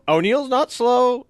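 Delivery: O'Neill's not slow, with not hit hard; stress on not. Real, not acted. Insisting to the interviewer that his buddy is fast, stressing not.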